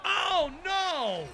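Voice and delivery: high, loud cry of dismay; a sudden, loud "oh no" at the game. Real, not acted. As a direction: yelp as the goal goes in. Opponent scores, game going badly.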